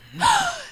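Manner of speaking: sharp high gasp; shocked gasp. Acted. Actress hearing the news, gasping. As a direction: gasp in outrage.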